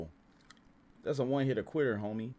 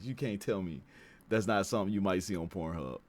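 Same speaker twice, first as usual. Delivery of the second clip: ordinary delivery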